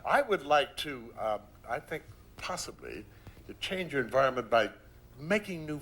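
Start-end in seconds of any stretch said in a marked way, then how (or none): none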